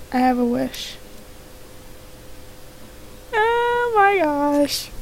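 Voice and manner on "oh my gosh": drawn-out gasp. Acted. Game character gasps in shock.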